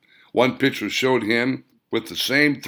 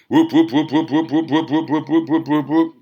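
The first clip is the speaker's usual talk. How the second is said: rhythmic whoop whoop of rotor blades, higher and louder; imitated helicopter whoop; helicopter blade sound; 6 semitones higher, 6 dB louder than usual. A narrator's reading. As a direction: chant the chopper blades like a beating rotor.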